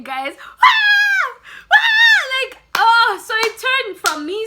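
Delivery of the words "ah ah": two very high, loud screams; imitated scream. Showing viewers how friend screamed, very high, loud screams. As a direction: scream it twice, full out. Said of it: "that's how he was screaming".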